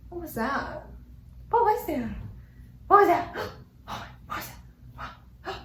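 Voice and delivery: hushed, alarmed questions after a noise; spooked by a sudden noise at home, hushed; startled. Real, not acted. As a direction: freeze and ask what that was.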